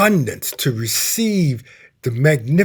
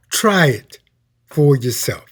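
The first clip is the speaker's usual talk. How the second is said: ordinary talk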